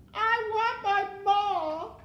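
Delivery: slow, high, loud wail; crying out. A narrator's reading. Mig crying for her mother, long loud wail. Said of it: "cried Mig".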